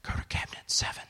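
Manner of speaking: hushed whisper to the nurse. Retold or imitated. Doctor whispers orders to the nurse behind the patient's back. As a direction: whisper it so the patient can't hear. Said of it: "he whispers".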